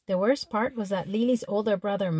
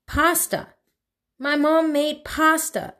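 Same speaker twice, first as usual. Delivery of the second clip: louder and higher, disbelieving; exasperated outburst; 6 semitones higher, 6 dB louder, slower than usual. Real, not acted. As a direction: blurt out Pasta in outraged disbelief. Exasperated outburst over mom's cooking.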